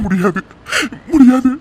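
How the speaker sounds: sudden loud refusal. Acted. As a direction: blurt out the refusal. Refuses to stay any longer.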